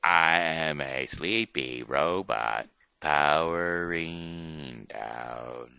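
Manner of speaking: slowing and dropping away into silence; robot powering down. Shown as a demonstration. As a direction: wind down like a dying robot. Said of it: "We will show you first".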